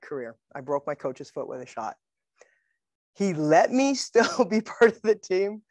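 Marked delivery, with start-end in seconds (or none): 3.1-5.6 amused, higher and drawn out; slow telling with a real laugh breaking in. Real, not acted. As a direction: laugh through the words. Laughs telling the punchline aftermath.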